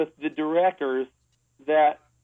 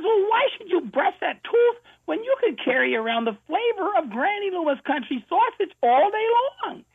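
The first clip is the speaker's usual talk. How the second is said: put-on much higher, cackly old granny in a radio skit; Granny Loomis voice; 14 semitones higher than usual. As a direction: play the fussy old grandmother, pitched way up. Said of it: "She says".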